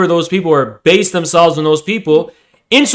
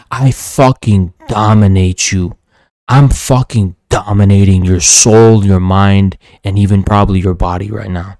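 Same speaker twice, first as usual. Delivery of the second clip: menacing boast; 5 semitones lower than usual. Real, not acted. Boasting to dominate the troll.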